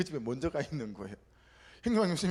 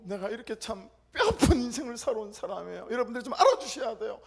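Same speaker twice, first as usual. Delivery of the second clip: tearful plea; 4 semitones higher, 3 dB louder than usual. Retold or imitated. Pleading his painful life story.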